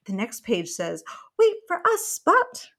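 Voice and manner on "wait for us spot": high, loud call to the puppy; bright storybook call. Acted. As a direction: call after Spot from a distance. Mom voicing friends calling Spot.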